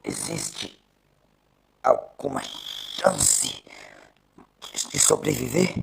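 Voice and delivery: slow, strained whisper rising at the end; failing man asking if he'll live; with effort. Acted. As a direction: drag each word out, barely holding on. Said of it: "disse ele com esforço".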